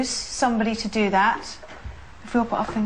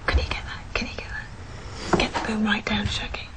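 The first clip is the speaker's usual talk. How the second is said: urgent hushed whisper; 3 dB quieter than usual. Real, not acted. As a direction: whisper orders to the crew without breaking the quiet. Hushed instructions to crew.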